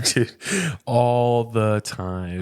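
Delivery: very slow, stretched ALL THE TIME; drawn-out admission. Real, not acted. Dad admitting his parenting doubts, emphatically drawn-out.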